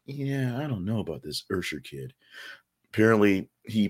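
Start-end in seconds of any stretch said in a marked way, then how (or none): 0.1-2.1 sceptical onlookers. Retold or imitated. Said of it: "and people are like".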